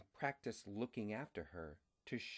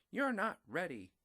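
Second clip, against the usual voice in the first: mom's line; 10 semitones higher, 3 dB louder than usual. A narrator's reading. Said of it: "His mom said".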